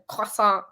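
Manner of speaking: croissant said the French way, kwah-SAHN with no final t; mock-French croissant, kwah-sahn; imitating her French croissant, kwah-SAHN. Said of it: "she somehow manages to always slip into conversation".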